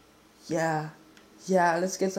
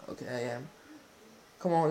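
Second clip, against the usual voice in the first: ordinary delivery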